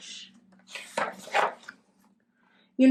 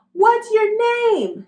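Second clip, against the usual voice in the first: much higher, louder and slow call; shouted question; 13 semitones higher, 6 dB louder, slower than usual. A narrator's reading. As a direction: yell it from the back of the class. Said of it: "someone shouted".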